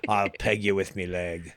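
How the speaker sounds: put-on a hushed, lazy pirate accent; pirate accent picking up the joke from his co-host. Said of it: "Or it could be a pirate accent".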